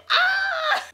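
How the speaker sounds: high, very loud "ah" at a joke; shocked shout. Real, not acted. Reacting to a savage yo mama joke, shocked shout. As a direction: yell out, floored by the punchline.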